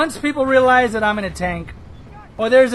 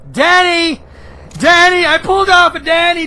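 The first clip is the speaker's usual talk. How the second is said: higher, louder triumphant shouts; excited whoop; 6 semitones higher, 5 dB louder than usual. Real, not acted. Gamer celebrating a plane kill to viewers, excited shouts.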